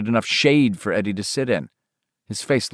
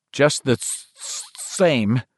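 troubled; 2 semitones higher, slower than usual. Acted. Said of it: "in a troubled voice".

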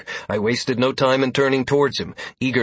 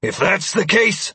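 snarling; 8 semitones higher than usual. A narrator's reading. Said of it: "I snarled".

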